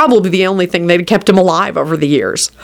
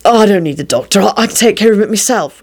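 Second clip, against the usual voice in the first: mildly sullen, annoyed refusal. A narrator's reading. Injured William refusing the doctor, mildly sullen. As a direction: brush the offer off with a touch of sulk. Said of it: "replied William sullenly. He was extremely annoyed".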